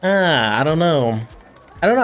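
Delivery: normal talking voice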